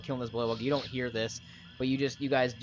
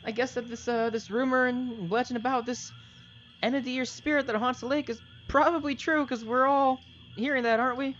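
much higher, louder NPC voice; Darius voice; 12 semitones higher, 5 dB louder than usual. Acted. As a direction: play the nervous companion Darius. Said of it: "he looks at you guys and goes".

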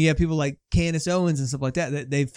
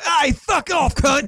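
high, put-on Australian bogan shout; Bogan accent; 11 semitones higher, 4 dB louder, much slower than usual. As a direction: yell it like a mock Aussie bogan. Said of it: "like, Bogan accent".

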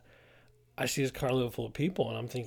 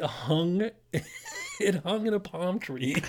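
slow words through laughter; laughing; 6 semitones higher, much slower than usual. Real, not acted. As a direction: laugh while you say it slowly. Laughing at own story.

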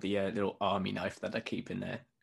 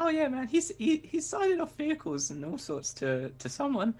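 very high, squeaky character voice; eager young rat. Acted. Trainee telling Ratterson about the General's dealings, high and eager. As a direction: spill the news in a squeaky voice.

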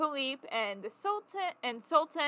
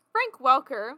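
normal talking voice

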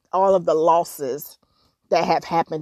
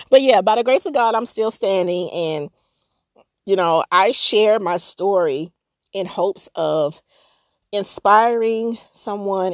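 normal talking voice

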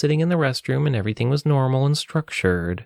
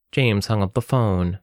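plain reading voice

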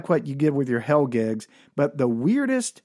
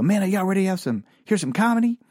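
higher, fast hype call to the crowd; mock yell to crowd; 7 semitones higher, faster than usual. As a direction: pump up the drunk crowd. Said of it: "I yell at the crowd".

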